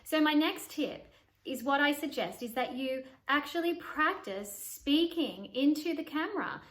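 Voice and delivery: ordinary delivery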